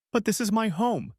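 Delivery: a touch of a tearful plea, high and strained; sobbing. A narrator's reading. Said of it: "she sobbed".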